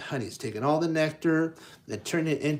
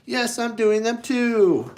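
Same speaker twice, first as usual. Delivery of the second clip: much higher, louder reflection reply; the mirror answering Pooh in a high, louder echo; 9 semitones higher, 7 dB louder than usual. Acted. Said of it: "the mirror probably tosses and goes".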